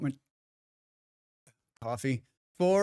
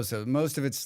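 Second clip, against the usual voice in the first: usual speaking voice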